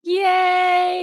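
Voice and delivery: high, held-out cheer; celebratory cheer. Real, not acted. Host applauds the storyteller's finished tale, cheering. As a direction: cheer like a one-person audience.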